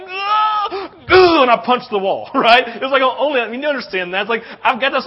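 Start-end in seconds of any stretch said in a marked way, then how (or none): 0.1-1.4 high, loud roar of pent-up emotion; frustrated roar. Retold or imitated. New father overwhelmed with love, loud high roar. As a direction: let out an overwhelmed yell before the punch. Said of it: "so I was like".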